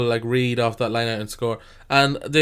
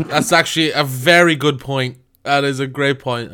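ordinary talk, nothing marked

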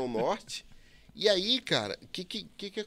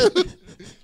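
loud high laugh; 13 semitones higher, 6 dB louder than usual. Real, not acted. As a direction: burst out laughing. Burst of laughter.

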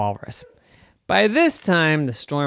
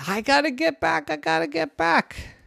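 put-on high girl voice. Girl in storm, urgent.

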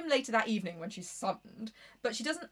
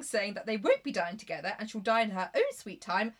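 normal talking voice

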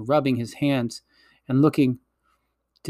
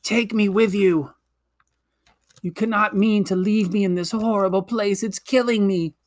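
faint pleading witch; 6 semitones higher than usual. A narrator's reading. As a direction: plead like a dying queen. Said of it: "cried the witch in a faint voice".

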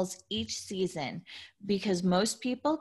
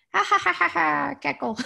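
high, loud cackling laugh; cackle; 8 semitones higher, 5 dB louder than usual. Retold or imitated. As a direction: cackle like a hen, hamming it up. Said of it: "The female turkeys cackle".

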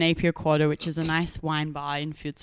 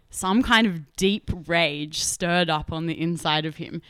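ordinary delivery

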